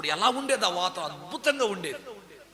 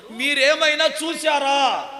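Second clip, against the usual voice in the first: loud, slow, pleading question; anxious woman calling loudly for help; urgent calling out; 6 semitones higher, 6 dB louder, slower than usual. Retold or imitated. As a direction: beg the watchmen for an answer. Mary urgently asking the watchmen.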